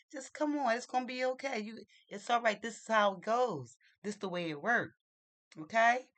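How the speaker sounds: exasperated coaxing. Retold or imitated. Adult begs upset boy to stay in line, exasperated coaxing. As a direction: coax a child who is walking off. Pleading with boy who walks off.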